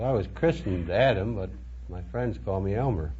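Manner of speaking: ordinary talk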